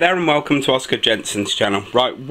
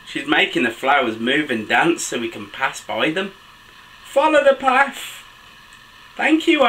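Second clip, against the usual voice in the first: put-on animated children's story reading, high excited exclamations; picture-book narration; 4 semitones higher than usual. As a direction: read to little ones, pitch leaping between narration and exclamations. Reads picture book aloud to children.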